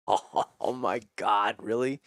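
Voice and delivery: drawn-out mock-amazed gasp; retold amazement. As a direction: stretch it out, then lift on "really". Retold thrilled reaction to sponsorship offer.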